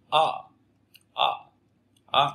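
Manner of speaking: "Á" shaped between Vietnamese ơ and a; short, relaxed "Á" with mouth naturally open; natural English Á. Shown as a demonstration. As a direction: say "Á" like ơ but sounding a. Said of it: "âm ơ nhưng mà phát âm Á".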